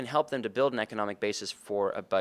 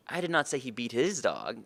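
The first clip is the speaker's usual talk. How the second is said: "his" swooping up in emphasis; stress on HIS. Shown as a demonstration. Sales trainer showing how verbal cues change meaning, stress on "his". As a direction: lean on the word "his". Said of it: "depending on which word I emphasize".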